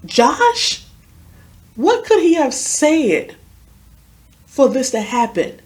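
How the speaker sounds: high, slow, incredulous question; retold disbelief. Questioning what a dead friend could have said, incredulous. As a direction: draw out the bewildered question from up high.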